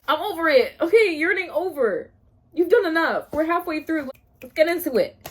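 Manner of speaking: high, loud, impatient demands; fed-up outburst. Real, not acted. Reader sick of the yearning, exasperated outburst. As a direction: call time on it loudly.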